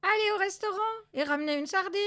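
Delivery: brisk, high-pitched order; commanding. A narrator's reading. Colonello sends someone for a sardine, commanding. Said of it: "ordonna Colonello".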